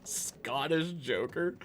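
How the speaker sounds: "Scottish Joker?" drawn out slowly with a rising, disbelieving tune; slow questioning "Scottish Joker?"; incredulous echo. Pretend. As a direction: repeat the name slowly as if it can't be serious. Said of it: "We need a Scottish Joker".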